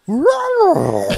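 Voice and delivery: high, loud monster roar; lion dinosaur roar. Retold or imitated. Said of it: "a lion growl mixed with, like, a dinosaur".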